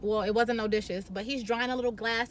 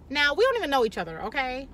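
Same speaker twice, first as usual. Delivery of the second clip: normal talking voice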